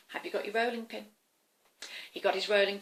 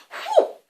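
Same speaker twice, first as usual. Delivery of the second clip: fire whoosh; 10 dB louder than usual. Acted. Dragon breathes fire.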